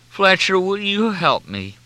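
high, slow whining plea. A narrator's reading. Junior begs Fletcher for help, whining. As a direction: plead in a drawn-out whine. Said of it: "no whining voice, no quivering".

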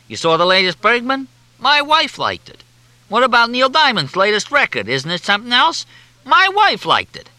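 cigar-chewing rant, climbing high and loud; Oscar Perlman. A narrator's reading. Columnist rants about men quoting their wives, exasperated. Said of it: "talking through a well-chewed cigar".

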